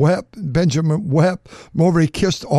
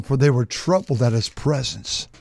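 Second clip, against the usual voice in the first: normal talking voice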